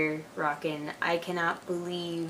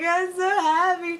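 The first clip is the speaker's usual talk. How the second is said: high, loud, drawn-out mock wail; mock happy crying; 11 semitones higher, 5 dB louder, slower than usual. Retelling her tears at school, mock happy crying. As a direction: imitate blubbering with joy. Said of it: "I just started crying at school".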